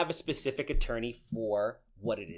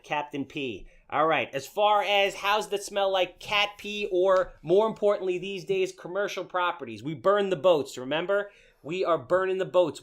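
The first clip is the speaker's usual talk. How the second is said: ad read; 5 semitones higher, 5 dB louder than usual. Acted. Pitches own permit business.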